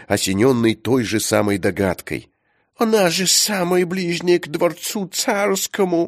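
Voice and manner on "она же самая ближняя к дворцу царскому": high, excited count voice; exclaiming count. A narrator's reading. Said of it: "ответил граф и воскликнул".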